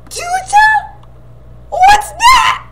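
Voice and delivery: high laughing alarm; high startled laughing cry; alarmed gasp. Real, not acted.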